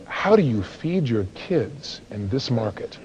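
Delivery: low, soft, slow question; acted doorstep pity, drawn out; pitying homeowner. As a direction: ask it slowly with hushed disbelief. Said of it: "They go".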